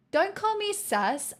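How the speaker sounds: high, slow, playful protest; mock indignant. Streamer objects to chat's teasing, mock indignant.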